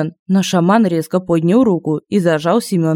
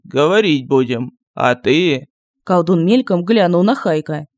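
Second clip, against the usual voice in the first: lightly voiced village sorcerer; gruff sorcerer voice; 4 semitones lower than usual. A narrator's reading. Sorcerer voice held. (ворчливый голос колдуна)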